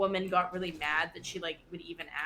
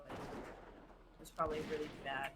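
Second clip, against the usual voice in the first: normal talking voice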